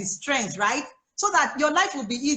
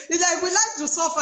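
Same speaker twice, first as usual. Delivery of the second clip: says it through a real laugh; 6 semitones higher than usual. Real, not acted. To the host about moms, laughing. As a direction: laugh at the silliness of it.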